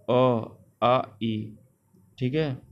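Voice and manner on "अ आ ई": अ, आ, ई with air only through the mouth; oral vowel demo. Shown as a demonstration. Teacher to students demonstrating oral vowels. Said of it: "हवा केवल मुख से निकलती है".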